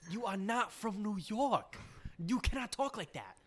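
high-pitched, slow, emphatic mock indignation; mock scolding. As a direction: slowly tell off a fake New Yorker, pitched high. Mock scolding of fake New Yorker.

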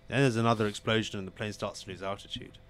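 ordinary talk, nothing marked

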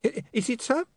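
high, hesitant question; high-pitched nervous query; flustered underling. Acted. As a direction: stammer it, puzzled and deferential. Puzzled overseer answers boss.

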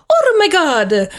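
high, slow mock-Italian exclamation; hammed-up cartoon plumber cry; Mario. Game master redoing the messed-up plumber's voice, high cartoon exclamation. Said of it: "That was my best Mario voice".

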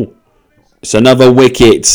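sudden cricket excitement; surprised. Real, not acted. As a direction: caught off guard by the wicket. Wicket falls while watching cricket.

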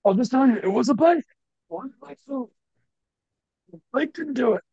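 squeezed-out, high, voiceless coach impression; Doc Rivers, lost voice. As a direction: mimic a coach who has lost his voice. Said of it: "He talks like he lost his voice".

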